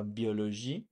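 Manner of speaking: biologie said as bee-o-lo-zhee; French pronunciation. Shown as a demonstration. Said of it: "The ending is different in French".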